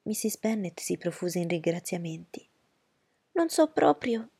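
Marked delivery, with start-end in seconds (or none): 3.3-4.3 higher, louder fussy mother; fussy society mother, higher voice; Mrs Bennet's voice. Acted. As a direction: play the anxious mother.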